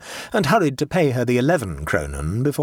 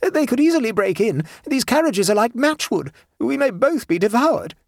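high-pitched nervous alarm; tremulous; 12 semitones higher, 3 dB louder than usual. A narrator's reading. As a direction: worry aloud, pitched high. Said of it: "said Abbleway tremulously".

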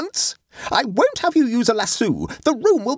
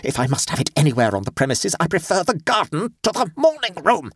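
indignant complaint, climbing higher as it goes; shaking voice; 6 semitones higher than usual. A narrator's reading. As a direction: voice shaking with outrage, building to a high-pitched protest. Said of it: "said Adela in a shaking voice".

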